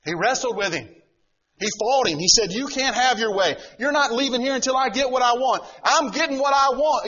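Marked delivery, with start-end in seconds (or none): 2.5-7.1 louder, stubborn demand; loud insistent wrestler's claim; defiant Jacob. Acted. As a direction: Jacob refusing to let go, pushed louder. Jacob defying the Lord.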